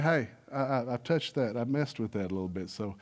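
a hint of a cautious uncle; uncle's warning. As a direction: quietly warn the boy like the uncle. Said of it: "but he told me".

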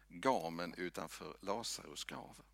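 normal talking voice

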